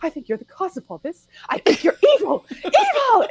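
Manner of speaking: mock hysteria climbing very high, laughing through it; imitated hysterical mother. Quoting the film's mother accusing Melanie, hammed-up hysteria.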